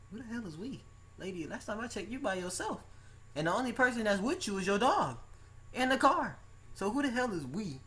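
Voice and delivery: high-pitched, exasperated comic rant; mock incredulous. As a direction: pitch it way up in baffled protest. Mocking a lone customer saying we.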